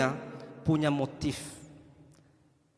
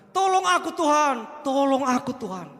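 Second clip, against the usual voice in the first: pleading woman; 8 semitones higher, slower than usual. Acted. Woman begging Jesus again.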